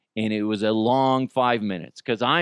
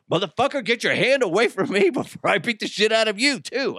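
mock threatening yell, higher pitched; imitating the furious neighbor snapping at him; angry threat; 8 semitones higher than usual.

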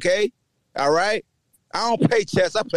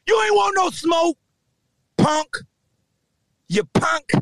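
shouting taunt; 8 semitones higher than usual. Real, not acted. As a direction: spit it out like a schoolyard dare. Taunting rivals.

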